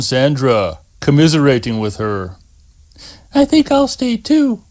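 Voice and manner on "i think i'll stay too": put-on high, gentle girl's voice; young woman voice. A narrator's reading. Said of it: "she said".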